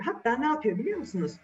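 plain reading voice

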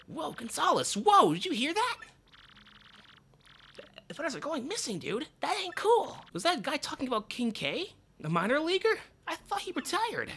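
high, breathless sidekick; squeaky partner voice. Acted. Partner whispering to Gonzalez in the vent, squeaky.

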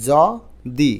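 য-দি split loudly, open jaw-vowel then di; syllable by syllable. Shown as a demonstration. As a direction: sound out য, pause, দি. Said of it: "উচ্চারণ করি কীভাবে?".

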